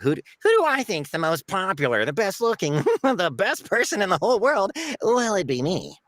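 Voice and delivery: high, self-admiring sleazy whine; Yan. Acted. Salesman naming himself best-looking in the world, whiny and sleazy. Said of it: "if Yan had a choice, he's like".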